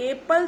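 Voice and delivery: एप्पल begun with the letter name A; letter-name mispronunciation. Shown as a demonstration. Phonics teacher repeats the wrong reading, 'AY-pal' for एप्पल. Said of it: "एप्पल तो नहीं बोल रहे".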